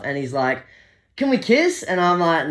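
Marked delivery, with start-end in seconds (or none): 1.2-1.8 high coaxing voice. Retold or imitated. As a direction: pitch way up on an asking tone. Drunk stranger asks for kiss.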